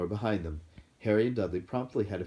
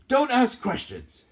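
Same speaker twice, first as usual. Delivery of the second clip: slow, loud, high snap; snapped; 10 dB louder, slower than usual. A narrator's reading. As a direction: cut him off sharply. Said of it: "snapped his uncle".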